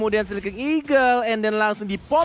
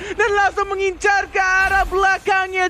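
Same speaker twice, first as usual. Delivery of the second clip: esports caster; 9 semitones higher than usual. Acted. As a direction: push the excitement up. Co-caster hype call. (komentator esports bersemangat)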